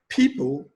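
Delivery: People pitched high on PEO-, falling after; stress on first syllable. Shown as a demonstration. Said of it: "placed on the first syllable".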